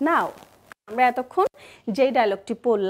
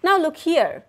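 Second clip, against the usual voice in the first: normal talking voice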